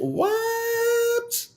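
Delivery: very high, disbelieving What? What?; a high incredulous gasp of what; shocked what. Real, not acted. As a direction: squeal it out in disbelief. Incredulous reaction to story.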